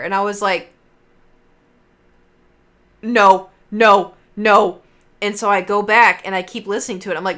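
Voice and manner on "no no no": much louder, panicked no; retold disbelieving cry. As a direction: refuse to believe what you heard. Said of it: "I sat there".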